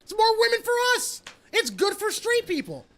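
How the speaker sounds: high, drawn-out mock declaration; high-pitched proclamation. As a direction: proclaim it way up high, stretching the words. Mock speech on benefits to straights.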